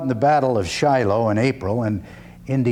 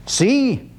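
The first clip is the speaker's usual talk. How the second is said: voiced father; 11 semitones higher than usual. Father in parable speaks.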